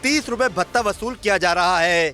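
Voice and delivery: punchy, measured TV report narration; news report voice-over. Acted. Reporter to viewers on parking mafia, news voice-over. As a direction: deliver it as a dramatic investigative news read.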